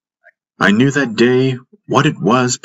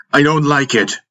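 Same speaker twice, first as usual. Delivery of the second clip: angered; 7 semitones higher than usual. A narrator's reading. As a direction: push back in a higher, angry voice. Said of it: "and that angered me".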